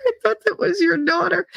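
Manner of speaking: teacher stunned by her mistake; surprised teacher. Retold or imitated. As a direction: act the teacher's shock. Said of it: "She was like".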